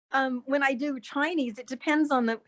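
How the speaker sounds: usual speaking voice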